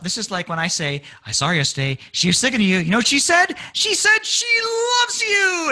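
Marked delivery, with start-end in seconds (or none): 1.3-5.7 building excitement. Acted. Friend finally revealing she loves you, climbing to a high shout. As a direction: start at usual pitch, climb to a high shout. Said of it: "the Beatles are building this up".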